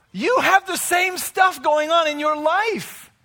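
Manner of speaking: loud, high mock challenge with a laugh; imagined listener. Listener mocking the preacher, loud and high. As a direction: call yourself out, half laughing. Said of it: "you think".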